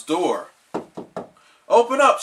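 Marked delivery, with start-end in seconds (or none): 1.7-2.2 loud high yell. Acted. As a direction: bang on the door and yell. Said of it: "she yelled".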